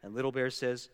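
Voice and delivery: ordinary delivery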